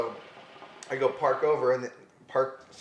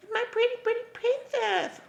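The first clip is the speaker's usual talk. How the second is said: mock princess voice. Mocking the princess nickname.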